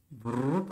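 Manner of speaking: short soft "brrr" sound effect; soft vocal swoosh, rising; imitated stroke noise. Shown as a demonstration. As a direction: make a quick trilled noise for a pen stroke.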